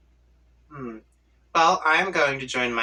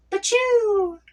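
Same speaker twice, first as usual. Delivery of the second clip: sneeze; 5 dB louder than usual. Acted. Letter flies off the screen.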